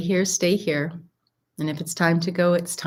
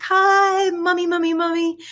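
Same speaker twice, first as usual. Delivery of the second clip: put-on little kid voice; 11 semitones higher, 5 dB louder than usual. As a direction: sing out very high like a small child calling mum. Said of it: "mirror that little voice back".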